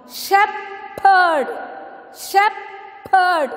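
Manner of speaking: "Shepherd" split as shep, herd with a pause; "Shepherd" divided clearly for learners; syllable by syllable. Shown as a demonstration. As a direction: say "Shepherd" in two beats, shep high, herd falling. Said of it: "So it is two syllable".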